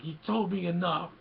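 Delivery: imitated defiant cry; mock defiant retort. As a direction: fire back like a wronged movie hero. Acting out Luke answering Vader.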